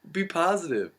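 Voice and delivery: hammed-up peppy slogan; cheesy poster voice. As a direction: sell it like a cheesy motivational poster. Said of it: "those cheesy motivational posters".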